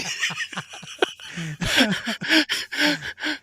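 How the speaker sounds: long high burst of laughter. Real, not acted. Guest cracking up at his own story, laughing hard.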